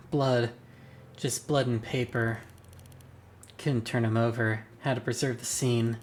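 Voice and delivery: normal talking voice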